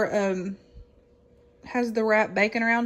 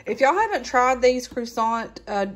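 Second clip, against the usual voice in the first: usual speaking voice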